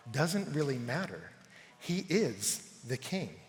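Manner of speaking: low, soft, measured declaration; solemn preaching. Acted. Preacher declaring Jesus Lord of all, solemn preaching. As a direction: state it gravely, with weighty pauses.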